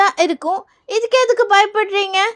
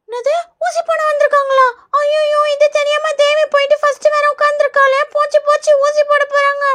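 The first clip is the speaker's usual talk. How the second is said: put-on high-pitched child voice full of dread; scared schoolchild, panicking; 6 semitones higher than usual. Schoolchild hears the nurse has come to give injections, panicking. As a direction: wail like a kid dreading a needle.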